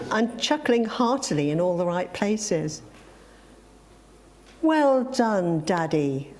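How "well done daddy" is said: emphatic "Well done, Daddy", stepping down in pitch; emphasizing each word. A narrator's reading. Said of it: "emphasizing each word with an emphatic nod".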